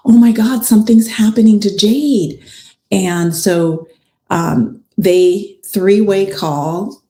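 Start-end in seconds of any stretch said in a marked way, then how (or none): 0.1-2.4 louder, higher, urgent cry for help; acting out an alarmed friend raising the alarm; retold alarm. Best friend phoning Keontae about Jade, retold in alarm. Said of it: "calls Keontae and is like".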